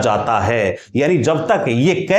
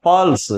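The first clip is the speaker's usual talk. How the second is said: "Pulse" with the opening प lengthened; stress on प; 3 semitones higher than usual. Shown as a demonstration. Teacher repeating the example for students, stress on प in "pulse". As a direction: put the weight on प in "pulse". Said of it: "पै के ऊपर बलाघात हो रहा है".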